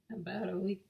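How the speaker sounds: ordinary talk, nothing marked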